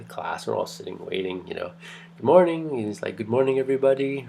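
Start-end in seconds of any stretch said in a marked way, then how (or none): 2.2-2.8 imitating a Kenyan British greeting, high and loud; a rough, lightly done try at his Kenyan British accent. Professor greets his class in a Kenyan British accent. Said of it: "I'm not doing justice to his, his accent". 3.2-4.3 a hint of a Kenyan British accent, quick and cheerful. Professor greets the whole class, hinting at a Kenyan British accent. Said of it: "I'm not doing justice to his, his accent".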